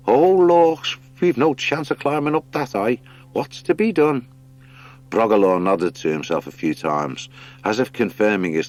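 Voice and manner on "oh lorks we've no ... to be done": high, loud fussy hare wail; dismayed hare. Acted. Hare despairs at the high window, high dismayed voice. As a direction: exclaim in dismay. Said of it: "viewed the sketch with dismay".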